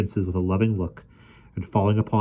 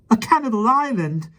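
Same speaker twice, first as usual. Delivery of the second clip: much higher, loud delighted shout; joyful cry; 6 dB louder than usual. A narrator's reading. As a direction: cry out with glee. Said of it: "cried the youngest prince, laughing with joy".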